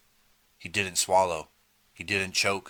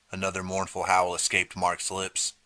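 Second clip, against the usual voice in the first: ordinary delivery, nothing marked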